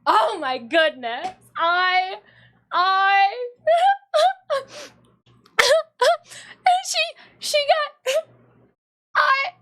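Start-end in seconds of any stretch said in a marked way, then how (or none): 4.5-9.5 laughing. Real, not acted. Cracking up mid story.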